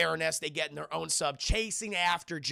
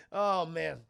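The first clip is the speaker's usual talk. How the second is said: ordinary delivery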